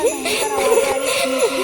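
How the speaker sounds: mock frantic squeaks; rising alarm. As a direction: push it up in fright. Imitating terrible noises, building.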